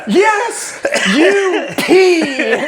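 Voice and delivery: high, laughing mock cheer; hammed-up triumphant yell. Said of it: "It's like".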